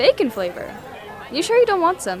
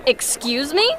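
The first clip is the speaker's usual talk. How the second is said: indignant; 9 semitones higher, 6 dB louder than usual. Acted. Insulted, rising anger.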